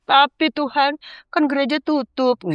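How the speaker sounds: high, slow whining excuse; whiny bargaining. Retold or imitated. A woman replaying her excuse to God, high whiny bargaining. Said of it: "Masih nawar terus ya".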